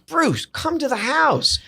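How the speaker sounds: high, slow, pleading call to come over; insistent invitation. Retold or imitated. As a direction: stretch it out, coaxing him to come. Urging friend on the phone.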